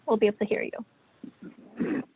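ordinary talk

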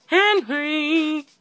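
high, drawn-out call with falling end; high-pitched exclaimed summons. Real, not acted. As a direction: call the name out high and long. Calls out the partner's name.

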